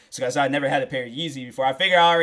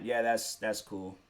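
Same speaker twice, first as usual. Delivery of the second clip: glum mumble; 5 semitones lower, 9 dB quieter than usual. Retold or imitated. Glum reply to girlfriend's excitement.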